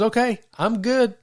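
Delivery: a touch of mock relief, all settled now; relieved Wesley. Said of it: "That John Wesley goes".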